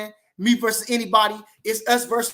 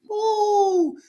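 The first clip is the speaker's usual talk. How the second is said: high, drawn-out "woo"; excited whoop; 12 semitones higher, 4 dB louder than usual. Real, not acted. Streamer hyped about his fighter's coming win, excited whoop.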